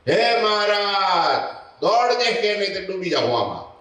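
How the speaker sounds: panicked cry from a sinking rider; calling out for help. Acted. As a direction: shout across the water to Maharaj. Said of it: "પછી નાથાને બૂમ".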